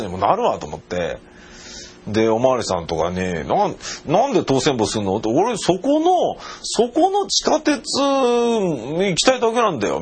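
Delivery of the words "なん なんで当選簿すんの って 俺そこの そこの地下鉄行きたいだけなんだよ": retold pleading. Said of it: "みたいなことを英語で言った".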